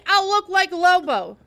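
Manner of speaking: loud, high, angry complaint. A narrator's reading. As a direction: protest hotly at full voice. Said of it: "I said angrily".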